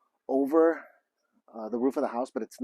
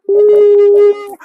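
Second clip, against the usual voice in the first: very high, loud "woo"; long celebratory whoop at full volume; excited whoop; 14 dB louder than usual. Real, not acted. Vlogger cheering the internet guy's arrival, whooping.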